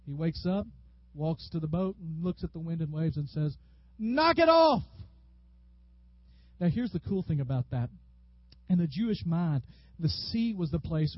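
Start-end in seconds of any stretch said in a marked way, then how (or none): 4.0-4.8 high, loud shouted rebuke; yelled command. Acted. Jesus to wind and waves, yelled like Dad. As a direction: shout it like a parent laying down the law. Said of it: "That's basically what Jesus does".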